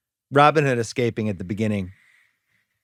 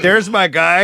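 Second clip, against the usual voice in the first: hammed-up cheer; 6 semitones higher, 7 dB louder, slower than usual. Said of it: "it was like".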